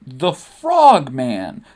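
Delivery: loud, very drawn-out incredulous echo; appalled disbelief. Acted. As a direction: stretch it out, loudly aghast. Sabe appalled at Padme's crush.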